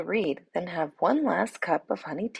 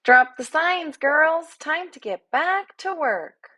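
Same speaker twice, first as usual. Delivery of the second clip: put-on higher, louder bee calling the girls; remarkably loud bee; 10 semitones higher, 5 dB louder than usual. A narrator's reading. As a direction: order the hive back to work. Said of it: "the remarkably loud voice of the very small bee".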